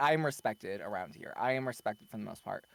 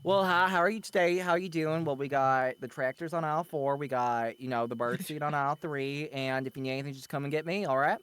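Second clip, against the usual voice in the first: put-on Southern accent. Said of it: "my Southern comes out whenever I especially, like, used to work retail".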